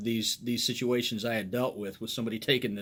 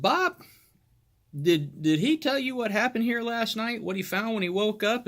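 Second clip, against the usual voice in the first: pointed question; 8 semitones higher than usual. Retold or imitated. Cop questioning Bob pointedly.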